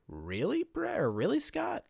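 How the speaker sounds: high-pitched disbelieving questions; incredulous questioning. Acted. Fan challenging a wrestler who lived the business, incredulous. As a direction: challenge them, voice leaping up in disbelief.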